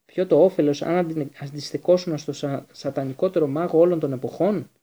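loud, incredulous questioning; forceful, halting outrage; angry. A narrator's reading. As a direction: throw the question back furiously. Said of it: "παραμορφωμένο από το θυμό".

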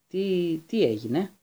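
groggy, hesitant question; lightly voiced feeble patient; just come round, faltering; weak voice. A narrator's reading. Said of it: "είπε ο Ρον με ασθενική φωνή".

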